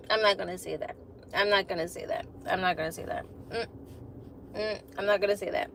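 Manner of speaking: low, soft aside to yourself; muttered self-restraint. Real, not acted. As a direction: drop the volume and talk yourself out of it. Holding herself back from a remark.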